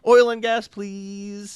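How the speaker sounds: high, loud pleading then a small please; mock beg, voice jumping up; begging for gas. EU leaders begging Putin for gas, mock pleading.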